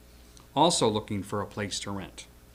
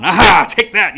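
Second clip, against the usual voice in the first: swashbuckling shout; 6 semitones higher, 15 dB louder than usual. Retold or imitated. Fencer's taunt mid-fight.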